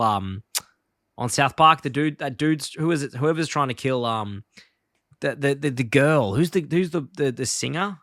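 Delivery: ordinary talk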